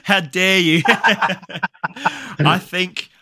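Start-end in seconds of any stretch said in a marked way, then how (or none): none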